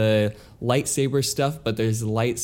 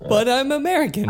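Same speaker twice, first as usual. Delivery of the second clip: mock indignant reply; 13 semitones higher, 3 dB louder than usual. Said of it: "they're like".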